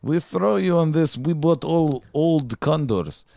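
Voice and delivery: high, loud Russian broken English; shouting Russian salesman bit; Russian accent. Pretend. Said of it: "I thought you were from Russia".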